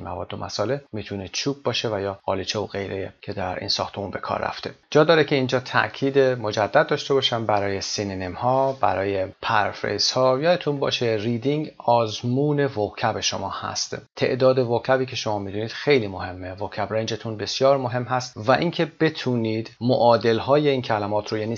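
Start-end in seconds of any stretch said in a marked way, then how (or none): none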